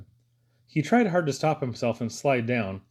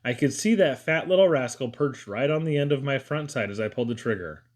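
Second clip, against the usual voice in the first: plain reading voice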